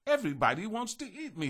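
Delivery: high, fast whiny cookie voice; complaining. A narrator's reading. Gingerbread man complaining to the butterfly, high quick whine. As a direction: grumble like a put-upon child. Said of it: "he complained".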